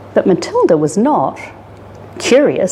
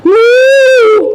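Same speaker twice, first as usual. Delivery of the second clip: a long, much higher, louder moo; calling for help; 8 dB louder than usual. Acted. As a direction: call out across the dark city. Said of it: "She tried calling for help".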